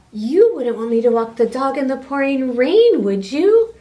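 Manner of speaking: loud, quick playful protest; teasing protest. Acted. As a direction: tease him, fast and loud. Wife argues with wizard.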